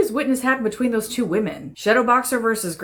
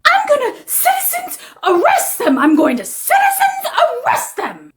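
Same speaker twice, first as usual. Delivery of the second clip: very high, hammed-up squeal; shrieking threat. Woman threatening auditors with citizens arrest, shrieking. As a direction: screech it in acted outrage.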